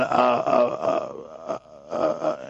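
halting uh uh, dropping low and soft at the end; stammering. Retold or imitated. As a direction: fumble for words, trailing off. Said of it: "I started just going".

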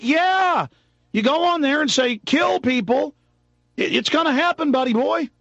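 high, incredulous-sounding mock reply; mocking retort. Mocking reply to the panicked emailer.

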